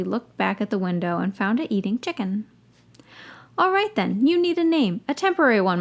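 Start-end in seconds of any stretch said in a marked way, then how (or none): 3.6-5.8 cheerful. A narrator's reading. Harry addressing the owl.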